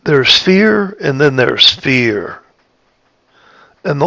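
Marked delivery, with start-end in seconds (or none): 0.0-1.0 "fear" said high and held up; light fear. Shown as a demonstration. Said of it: "is in the tone of my voice". 1.4-2.4 "fear" lower, slow and falling; grave fear. Shown as a demonstration. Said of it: "is in the tone of my voice".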